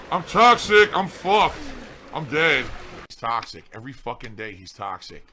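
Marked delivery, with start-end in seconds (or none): 0.1-2.6 mock drama queen. Overdramatic drama queen mockery.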